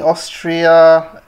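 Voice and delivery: ordinary talk, nothing marked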